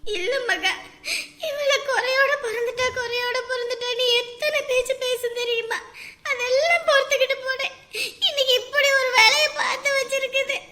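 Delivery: weepy complaint; tearful lament. Acted. Mother telling Maha of all the taunts she bore, crying. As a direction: plead through tears. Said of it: "அப்படியெல்லாம் பேசாதீங்க".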